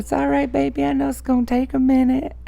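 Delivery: high, sweet reassuring tone; gentle high reassurance; sweet coaxing. Retold or imitated. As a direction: coax it like a baby. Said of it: "I said".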